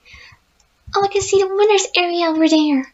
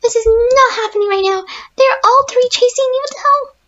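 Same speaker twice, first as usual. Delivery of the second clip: high, loud, frantic cry; frantic panic; 6 semitones higher, 4 dB louder than usual. Real, not acted. Gamer chased by three robots, frantic yelling. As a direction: yell it while fleeing.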